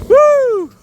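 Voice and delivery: high loud woo; excited whoop. Real, not acted. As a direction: let out a joyful woo. Bouncing on trampoline.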